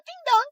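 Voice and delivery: doorbell imitation.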